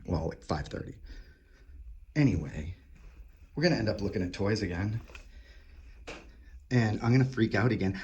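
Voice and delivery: ordinary talk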